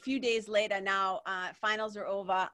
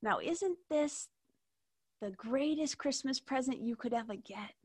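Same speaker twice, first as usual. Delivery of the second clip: put-on sweet old nana voice; 3 semitones higher, 3 dB quieter than usual. Nana speaks to grandchild.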